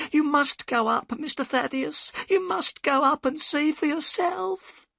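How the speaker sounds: put-on very high, trembling old woman's voice; a scared old servant pleading; frightened housekeeper. A narrator's reading.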